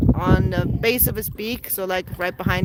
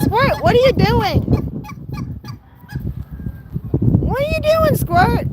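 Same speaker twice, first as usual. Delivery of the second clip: much higher and louder teasing coo; high pet talk; 4 dB louder than usual. Real, not acted. Owner asking the hen what she's doing, in high pet talk. Talking to her hen and rooster.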